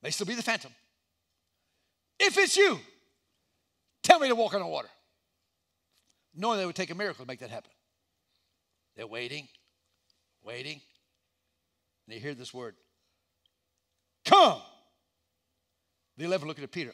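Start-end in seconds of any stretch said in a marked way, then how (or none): 2.2-4.9 high, loud call across the water. Acted. Peter calls out into the dark. 14.2-14.6 one loud, high command; booming call. Acted. Jesus answers with one word.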